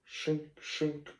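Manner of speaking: soft mimicked scissor snip sounds; quiet "shink, shink" scissor noise. Barber's scissors imitated.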